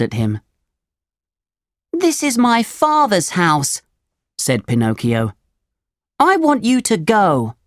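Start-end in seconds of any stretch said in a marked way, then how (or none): 1.9-3.8 put-on high, childlike storybook puppet; high-pitched indignant puppet; little puppet voice. A narrator's reading. Puppet challenges the cricket in story. 6.2-7.6 put-on high, childlike storybook puppet; high-pitched indignant puppet; little puppet voice. A narrator's reading. Puppet orders the cricket out.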